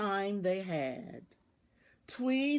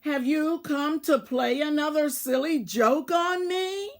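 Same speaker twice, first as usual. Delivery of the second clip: angry; 6 semitones higher, 5 dB louder than usual. A narrator's reading. Tweez angrily confronts the mice.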